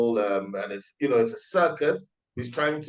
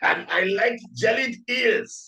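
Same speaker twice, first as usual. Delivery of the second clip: high, slow hammed-up British; mock British accent; 10 semitones higher, slower than usual. Co-host piling on, high mock British accent. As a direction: silly fake British boast. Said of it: "try to talk like a British person".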